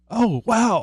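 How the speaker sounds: surprised. Real, not acted. Realizes the third monitor works.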